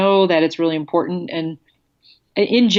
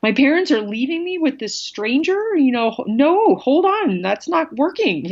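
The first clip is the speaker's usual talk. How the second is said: put-on higher, protesting kid's voice; a little one panicking as parents leave; alarmed young child; 7 semitones higher than usual. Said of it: "your young child of like".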